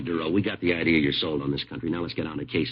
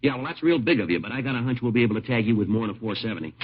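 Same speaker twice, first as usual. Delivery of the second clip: louder, higher, mocking scorn; sarcastic; 5 semitones higher, 6 dB louder than usual. Detective mocking the suspect's offer to take the blame, sarcastic and loud. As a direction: needle him with sarcasm.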